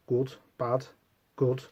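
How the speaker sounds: sleepy, soft, detached words; half asleep. Acted. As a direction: murmur it to yourself, nearly asleep. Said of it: "almost half asleep".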